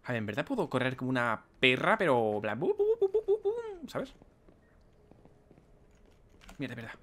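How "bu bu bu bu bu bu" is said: high mock babble. Imitating sound, high pitched.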